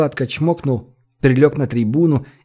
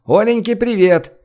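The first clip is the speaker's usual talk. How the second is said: high, raised greeting from afar; calling out; 7 semitones higher, 3 dB louder than usual. A narrator's reading. Лёшечка sending greetings into Гош's phone call, high shout. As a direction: call a greeting across the flat. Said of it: "крикнул ему из комнаты Лёшечка".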